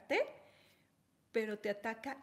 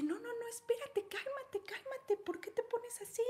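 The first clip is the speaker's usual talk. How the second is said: high anxious pleading voice; doctora asustada; 12 semitones higher than usual. Retold or imitated. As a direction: beg her to calm down, high and nervous. Doctor voiced calming assistant. (doctora suplicando con voz aguda)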